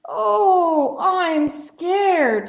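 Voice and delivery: small frightened cry, high and loud; scared little bear. Acted. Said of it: "said Little Bear".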